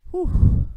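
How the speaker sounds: loud breathy whoosh of relief; huge relieved exhale. Real, not acted. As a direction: blow out all the tension.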